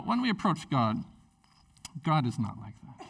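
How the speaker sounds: ordinary talk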